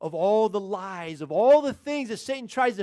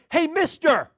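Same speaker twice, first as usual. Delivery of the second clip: higher-pitched parrot call; talking parrot; 7 semitones higher, 4 dB louder, slower than usual. Acted. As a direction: hail someone like a cheeky parrot. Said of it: "this voice that said".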